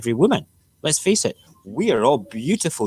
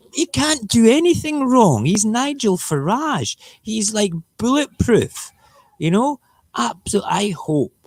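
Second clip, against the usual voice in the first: high, sarcastic put-on voice; sarcastic mock awe; 11 semitones higher than usual. Vlogger mocks the politician as untouchable, high and sarcastic. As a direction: gush sarcastically about how untouchable he is.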